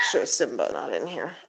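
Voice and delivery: ordinary delivery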